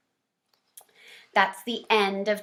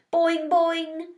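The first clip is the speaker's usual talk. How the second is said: high, twangy boing of a frog; 8 semitones higher than usual. A narrator's reading. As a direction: sing it out like a springy frog. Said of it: "Og said in his twangy voice".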